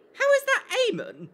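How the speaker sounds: high, loud, incredulous question; baffled disbelief. Real, not acted. Streamer questions how Amon is said, baffled. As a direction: voice leaps up in disbelief.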